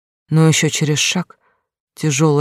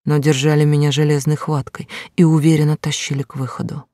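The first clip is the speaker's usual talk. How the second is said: ordinary delivery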